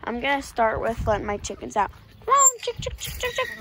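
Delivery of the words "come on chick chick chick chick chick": high sing-song chicken call. Real, not acted. Calling chickens out of coop.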